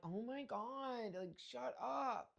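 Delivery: slow, drawn-out eye-roll; slow mocking shut up; mock dismissive. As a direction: stretch it out, unimpressed. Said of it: "And I'm like".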